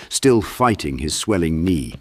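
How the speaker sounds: usual speaking voice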